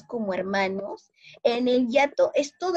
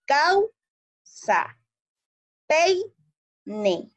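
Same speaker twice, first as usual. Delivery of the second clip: "Causa" and "Peine" in two beats, higher first syllable, pause, then the second; stressed diphthong, syllabified; 6 semitones higher, much slower than usual. Shown as a demonstration. Teacher demonstrates diphthongs for pupils, "Causa" and "Peine" split after the stressed diphthong. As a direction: break into "Cau... sa", "Pei... ne", diphthong held together. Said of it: "mi mayor fuerza de voz va a estar en la A".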